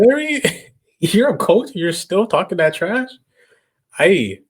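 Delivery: high-pitched amused heckling; laughing tease. Real, not acted. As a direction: rib him through laughter. Teasing Bird over the clip.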